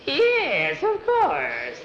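high-pitched witch agreeing; high, eager storybook witch. Acted. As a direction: squeal it in a put-on witch voice.

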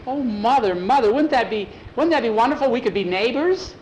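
high, loud, thrilled voice; excited girl. Acted. Nancy begging her mother to stay, thrilled. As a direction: gush to mother with delight.

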